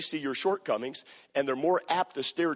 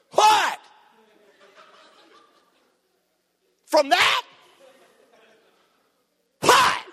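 much higher, loud shocked cries; astonished outburst; 10 dB louder, much slower than usual. Acted. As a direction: sound stunned at their fear.

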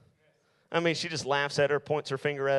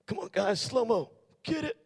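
mock vengeful king; 2 semitones higher than usual. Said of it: "He could have looked at that man and said".

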